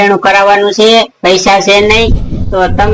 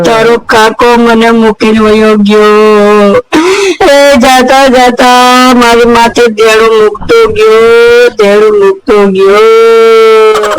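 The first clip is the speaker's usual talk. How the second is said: crying lament; slower than usual. Acted. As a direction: wail over your dead husband, dragging the words. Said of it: "કાકી જાજુ રોવો માં".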